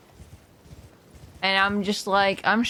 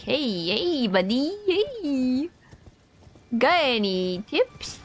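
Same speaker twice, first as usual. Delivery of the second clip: imitating asking a friend; 4 semitones higher, much slower than usual. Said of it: "so I could be like".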